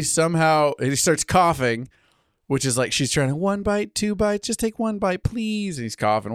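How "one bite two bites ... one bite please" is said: pleading Fluttershy. Retold or imitated. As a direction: coax the bunny to eat.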